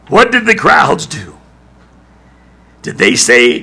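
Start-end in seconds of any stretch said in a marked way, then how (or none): 0.1-1.4 preacher pressing the congregation, louder; louder, higher repeat. Shown as a demonstration. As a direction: punch WHAT, much higher. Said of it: "And what did the crowds do?".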